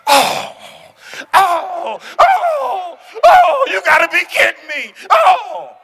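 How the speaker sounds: shouted, soaring oh's of disbelief; hammed-up shocked outcry; mock disbelief. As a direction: wail it as if the news just hit. Acted shock at bad news.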